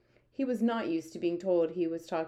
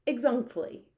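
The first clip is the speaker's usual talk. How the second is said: higher, mildly excited agreement; eager cry; 6 semitones higher, 3 dB louder, slower than usual. A narrator's reading. BFG confirms what Sophie explained, crying out. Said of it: "cried the B F G".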